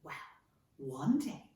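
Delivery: ordinary delivery, nothing marked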